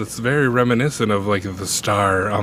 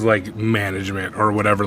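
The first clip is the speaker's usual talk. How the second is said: ordinary delivery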